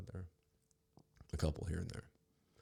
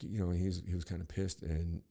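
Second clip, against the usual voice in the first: ordinary talk, nothing marked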